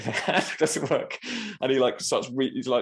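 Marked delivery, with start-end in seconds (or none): 0.0-1.2 panicky. Retold or imitated. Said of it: "I'm like".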